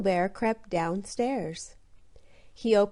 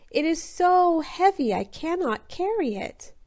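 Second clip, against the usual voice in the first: shouting; 8 semitones higher than usual. A narrator's reading. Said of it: "Little Bear shouted so loud".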